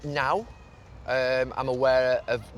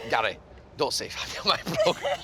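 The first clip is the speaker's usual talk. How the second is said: mock Scottish scold said through laughter, higher; retelling the producer's line while cracking up; half-laughed Scottish producer voice; laughing Scottish impression; 4 semitones higher than usual. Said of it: "It's my poor Scottish accent".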